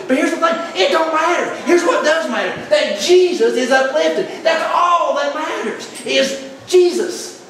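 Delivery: a fired-up pastor driving the point home; full-force preaching. Real, not acted. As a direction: preach it hard to the congregation. Preaching climax.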